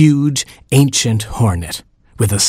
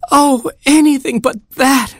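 very high, horrified cry; shriek. A narrator's reading. Queen frog shrieks in horror at the curse. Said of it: "shrieked the Queen Frog".

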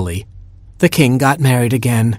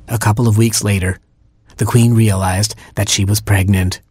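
plain reading voice

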